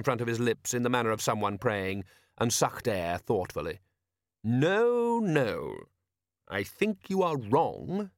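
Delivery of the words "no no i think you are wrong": drawn-out no, starting high and sinking; thoughtful patrician. A narrator's reading. Patrician answers sergeant's protest after thought, measured. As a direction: weigh it slowly, then disagree. Narrator voices Lord Vetinari.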